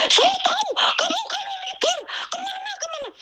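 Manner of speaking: very high, thin mimicking voice; mock angry employer. Maid mimics employer prying into her day off, mock angry. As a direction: imitate the boss nagging in a softened squeal. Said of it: "dia yang marah-marah itu".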